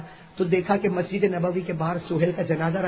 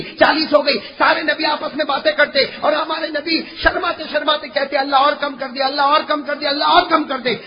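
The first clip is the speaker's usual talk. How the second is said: impassioned preaching; 6 semitones higher, 4 dB louder than usual. Acted. Preaching at full force.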